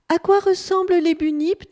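high, loud, keen question; eager questioning. A narrator's reading. Bunip asks wallaby about bunips, eager. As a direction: ask it urgently, voice lifted.

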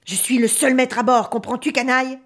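raging captain roaring in madness; loud, crazed, domineering yell; furious shout. Acted. Mad captain strikes his son.

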